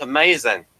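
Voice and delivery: normal talking voice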